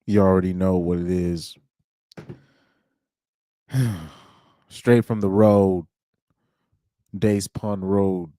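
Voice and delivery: a hint of [name], laid back; mild [name] imitation; [name] impression. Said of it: "my best [name] impersonation on".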